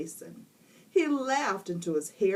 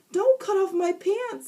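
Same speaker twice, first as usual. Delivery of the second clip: put-on high, loud boy's plea; panicked protest; 14 semitones higher, 7 dB louder than usual. A narrator's reading. Boy protesting to teacher, loud panic. Jason's voice.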